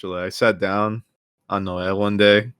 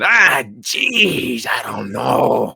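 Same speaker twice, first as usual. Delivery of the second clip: put-on Brooklyn accent; 2 semitones higher, much slower than usual. Haggling with car salesman, hammed-up Brooklyn accent. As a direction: ham up a hesitant Brooklyn guy. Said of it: "give him a Brooklyn accent".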